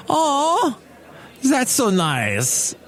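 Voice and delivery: put-on high, slow Russian-accented coo; thick Russian accent. Russian lady admires man walking his dog, high Russian-accented voice. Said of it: "with her Russian accent".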